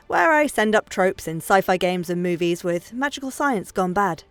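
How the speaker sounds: ordinary talk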